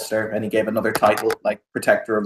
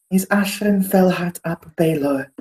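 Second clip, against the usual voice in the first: put-on "Ashrin Felhart ap Baelor" with heavily rolled r's in a high noble accent; thick noble fantasy accent on "Ashrin Felhart ap Baelor"; 7 semitones higher than usual. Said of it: "You rolled your tongue a lot when you said that dude's name".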